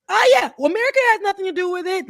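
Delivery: sarcastic mock denial. Mocking America's denial.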